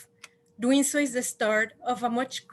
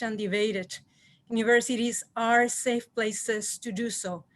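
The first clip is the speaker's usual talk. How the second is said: usual speaking voice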